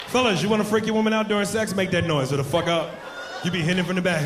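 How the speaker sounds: ordinary talk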